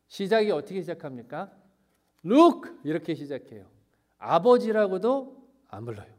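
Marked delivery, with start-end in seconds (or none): 2.2-2.7 acted sharp outburst, high and loud; rough, angry. Elder son refuses to say father, rude angry shout. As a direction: throw out an indignant, shouted call for attention. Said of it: "라고 너무너무 거칠게".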